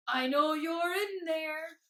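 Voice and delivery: slow, loud booming dragon voice; rumbling dragon. A narrator's reading. Unseen dragon calls Mister Tickle out of the bush, slow rumbling boom. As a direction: boom it out, drawing the words out. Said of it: "rumbled a very deep voice".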